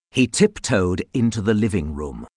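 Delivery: plain reading voice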